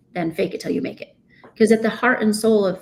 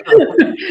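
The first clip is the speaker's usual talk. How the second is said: a big, bright burst of laughter at his own joke; cracking up at the syllable joke; loud high laugh; 12 semitones higher, 10 dB louder than usual. Real, not acted.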